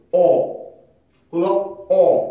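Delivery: 어 as a loud open vowel, no R; open vowel demo. Shown as a demonstration. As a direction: say 어 with the mouth open, before any R. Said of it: "입이 두 개인 거지".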